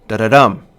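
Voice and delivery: "da-da-dum" with two light beats, then a loud "dum"; stress on last syllable. Shown as a demonstration. Lecturer demonstrating an anapest to students, "da-da-dum" stressed on "dum". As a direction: land hard on "dum" in "da-da-dum". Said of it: "followed by a stressed syllable".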